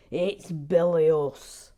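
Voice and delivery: loud, slow pompous bilious; imitating Gary Blair. Mocking Gary Blair's verdict again. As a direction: lean on bilious like him. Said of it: "That's how he talks".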